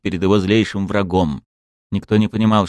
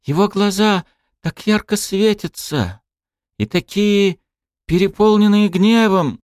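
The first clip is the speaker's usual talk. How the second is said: put-on awed girl; 13 semitones higher than usual. Awed crowd voice.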